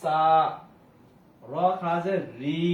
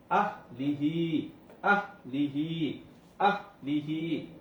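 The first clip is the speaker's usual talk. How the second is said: slow drawn recitation; 2 semitones lower, much slower than usual. Shown as a demonstration. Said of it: "হা খাড়া জের হি".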